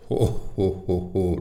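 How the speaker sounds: booming fire chief "ho ho"; big hearty laugh. A narrator's reading. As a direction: jolly boss chuckle, lightly voiced. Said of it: "lachte der große Feuerwehrchef".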